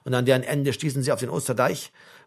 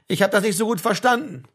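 higher, raised voice from the ranks; calling out; 5 semitones higher than usual. A narrator's reading. Baumann explains he didn't catch it, calling out. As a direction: project it to the sergeant. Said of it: "rief Baumann".

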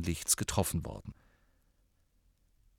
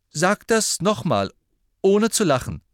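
higher, louder, slowed demand; sceptical challenge; 9 semitones higher, 7 dB louder than usual. Acted. Harry daring Ron to repeat it, sceptical and loud. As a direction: dare him to keep a straight face.

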